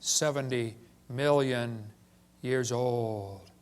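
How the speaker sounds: slow, low, hushed reverent drone; sanctimonious tone. Retold or imitated. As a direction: intone it like it's sacred scripture. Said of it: "they always get that sanctimonious tone in their voice".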